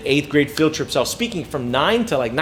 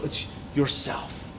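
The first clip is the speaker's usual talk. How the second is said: a much softer whisper, slowed right down; whispered punchline; 4 semitones lower, 12 dB quieter, much slower than usual. Acted. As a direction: breathe the answer to the audience like a secret. Intimate punchline to audience.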